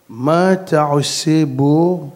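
slow, loud challenge to an intruder; menacing interrogation. Retold or imitated. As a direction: drag out each word loudly and slowly. Stranger repeats his challenge.

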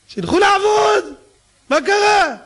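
high, loud scoff of the stingy; shouting them off to work; mock indignant shout. Mocking those who demand work. (צעקה מזלזלת בלעג)

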